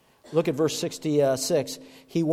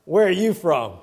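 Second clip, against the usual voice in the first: put-on Southern accent; 4 dB louder than usual. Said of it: "with that southern dialect".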